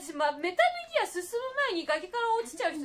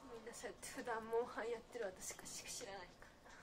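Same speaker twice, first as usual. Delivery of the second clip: whispering; 6 semitones lower, 12 dB quieter than usual. Real, not acted. Whispered aside about herself.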